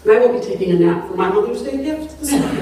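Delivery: giggling, worn out, apologizing; tired weepy laughter. Real, not acted. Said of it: "I don't know what's so weepy".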